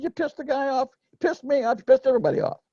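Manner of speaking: high-pitched mock telling-off, "pissed" pushed up; retold scolding. Scolding colleague in the car.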